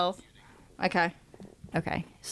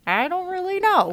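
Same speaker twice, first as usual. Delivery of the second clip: higher, mumbled through a full mouth; mouth stuffed with food; 9 semitones higher than usual. Retold or imitated. Quoting Remy's brother talking with his mouth full. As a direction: say it like a rat chewing something gross. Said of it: "He goes".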